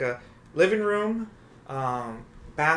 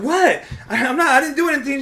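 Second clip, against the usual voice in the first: high, fast, loud panicked protest; panicked denial; 11 semitones higher, 6 dB louder than usual. Retold or imitated. Startled boyfriend defending himself, high and fast. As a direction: blurt out your innocence in a panic. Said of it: "And I'm like".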